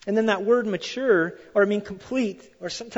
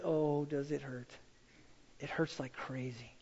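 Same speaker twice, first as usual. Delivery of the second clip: quiet pained; 6 semitones lower, 15 dB quieter than usual. Real, not acted.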